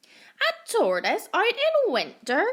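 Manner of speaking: squawky duck asking in disbelief; quacking duck. A narrator's reading. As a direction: sound like a mocking duck. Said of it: "quacked the duck".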